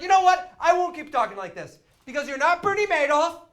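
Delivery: retold tirade at full blast; furious shouting. Acted insult climax at phone agent.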